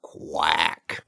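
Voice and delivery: deep, loud drawn-out quack; one big low quack to end; low duck quack. Acted. Closing duck sound.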